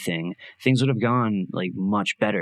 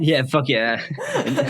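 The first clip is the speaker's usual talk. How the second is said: laughing; 9 semitones higher, 5 dB louder, slower than usual. Real, not acted. Laughing after choking fit.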